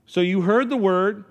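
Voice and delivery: high, loud, building call to come forth; preaching climax. Acted. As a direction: drive it home to the congregation.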